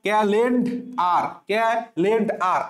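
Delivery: Calendar spoken slowly, each syllable clear; slow model of calendar; slow careful reading. Shown as a demonstration. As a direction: read calendar slowly for the class. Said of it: "তাহলে এখন থেকে পড়বা calendar".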